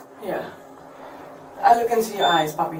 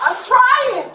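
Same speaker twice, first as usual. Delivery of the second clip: angry outburst; 11 semitones higher, 3 dB louder than usual. Real, not acted. Snapping back at officer.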